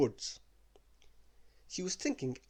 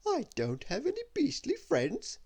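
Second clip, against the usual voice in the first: much higher, softened prissy voice; softened lady voice; 13 semitones higher than usual. Acted. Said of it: "her voice did soften".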